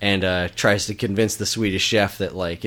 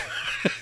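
laugh; 9 dB quieter than usual. Real, not acted. Laughing at the dog bit.

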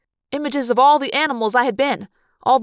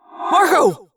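mild yell; 5 semitones higher than usual. Acted. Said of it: "Jake yelled".

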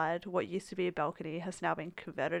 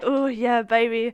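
hammed-up sexy purr; mock seductive, playing it up; 3 semitones higher, 8 dB louder, slower than usual. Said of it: "That sounds sexy".

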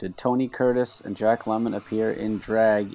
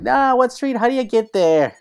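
mocking voice; 13 semitones higher, 5 dB louder than usual. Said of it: "They're like".

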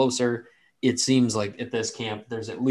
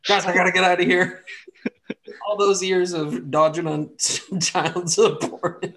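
loud, higher, talking through laughter; laughing; 8 semitones higher, 4 dB louder than usual. Real, not acted. Game master jokes with players about child support, laughing. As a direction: crack up while trying to get the joke out. Cracking up over running joke.

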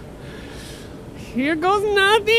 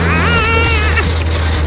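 wounded soldier gasping; 3 semitones higher, 4 dB louder than usual. Acted. Ramon chopped by fan.

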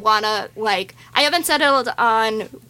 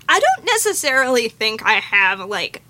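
ordinary talk

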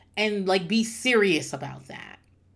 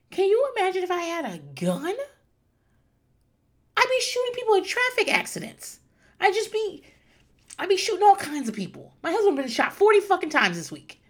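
high-pitched comic outrage; worked-up rant; 12 semitones higher, 4 dB louder than usual. Real, not acted. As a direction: rant way up high, getting louder. Comic rant about her temper.

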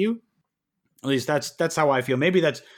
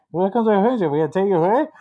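a higher, louder put-on voice of a drive-through worker at the speaker box; 6 semitones higher, 5 dB louder, much faster than usual. Retelling a drive-through visit, imitating the worker on the speaker, higher and louder. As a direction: mimic the garbled worker at the order box. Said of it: "somebody comes on and they say".

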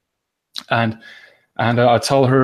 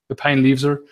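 usual speaking voice

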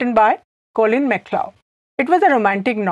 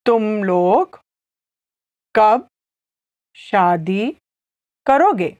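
तुम लोग, कब, शादी, करोगे with pauses; slow Hindi drill; slow, word by word; faster than usual. Shown as a demonstration. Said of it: "I'll say it again slowly".

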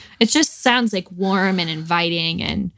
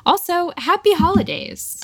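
cheery; 10 semitones higher than usual. Real, not acted. Cheery holiday greeting.

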